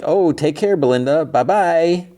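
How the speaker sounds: high, singsong mock goodbye; lilting, high-pitched send-off in mimicry; cheery neighbor farewell. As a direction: imitate a neighbor trilling goodbye. Neighbor's breezy farewell, acted out.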